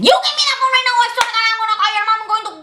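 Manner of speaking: high, fast mock-furious threats; mad teacher. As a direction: rattle off threats as an imitated irate teacher. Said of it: "she'll be so mad".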